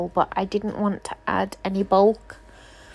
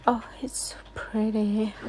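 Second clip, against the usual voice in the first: whisper; 5 dB quieter than usual. Real, not acted. Admiring the finished nail.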